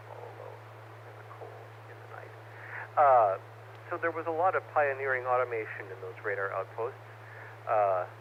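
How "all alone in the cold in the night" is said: hushed spooky whisper. Pretend. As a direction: breathe it out like a ghost story. Spooky aside about lonely radar posts.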